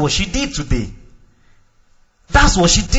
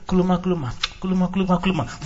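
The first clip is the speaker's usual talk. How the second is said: slow, soft, even kuluma chant; slow calm tongues; 6 dB quieter, much faster than usual. Shown as a demonstration. Preacher showing calm praying in tongues. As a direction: pray kuluma slowly and gently. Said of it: "The only difference is the speed".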